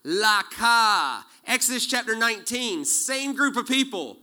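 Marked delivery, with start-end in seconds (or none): 0.0-1.2 proclaimed laka. Retold or imitated. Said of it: "they hear".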